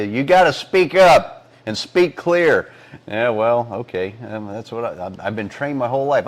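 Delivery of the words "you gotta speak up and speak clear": louder, higher, slow teacherly order; school teacher. Retold or imitated. Telling his co-host what school teachers say, emphatic teacher voice. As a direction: speak up firmly like a teacher. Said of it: "School teachers tell you".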